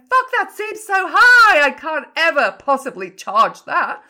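mock freak-out. Coach acting a client shocked by their own price, panicked. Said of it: "are you kind of freaking out going".

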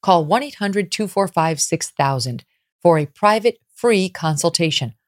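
upbeat, punchy sponsor read. Acted. Host reading a tax relief ad to the audience, upbeat ad voice. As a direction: sell it straight to the listeners.

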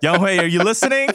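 playful call-out. Pretend. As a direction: call out to Young Hoi as if he's listening. Calling out to the kicker.

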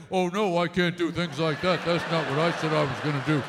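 low, dopey man impression; mocking man's voice. Wife to husband, mocking his low dopey voice. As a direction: play the whiny boyfriend, low. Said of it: "imitate the voice of the man".

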